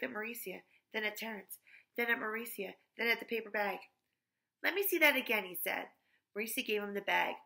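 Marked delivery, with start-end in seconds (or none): 4.6-5.4 high, loud sudden request; startled. A narrator's reading. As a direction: do a double take. Louis startled by money.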